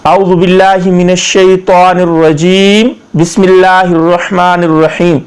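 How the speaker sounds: measured Arabic recitation, long held vowels; formal tajweed delivery; Quranic recitation. Shown as a demonstration. Recites the opening invocation.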